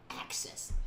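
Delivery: whispered access; hushed whisper. Real, not acted. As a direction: breathe access softly. Whispered call response.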